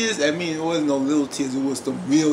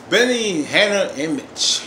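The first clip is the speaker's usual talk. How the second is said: slow, drawn-out, louder announcement; announced scene title; 3 dB louder than usual. Shown as a demonstration. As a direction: call out the names slowly, stretched. Announces the next scene's characters.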